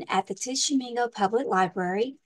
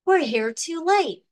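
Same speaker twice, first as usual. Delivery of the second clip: higher, dismayed pirate cry; a touch of a gasp of dismay; 6 semitones higher, 4 dB louder than usual. Acted. Pirates find a rival crew got there first, dismayed. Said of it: "the pirates gasp".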